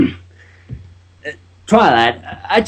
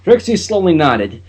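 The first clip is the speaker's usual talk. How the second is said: plain reading voice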